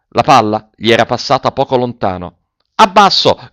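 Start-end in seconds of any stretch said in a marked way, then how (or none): 2.7-3.5 high, loud angry command; imperious, irritated shout. A narrator's reading. Officer ordering the boy down, imperious shout. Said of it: "imperioso e irritato".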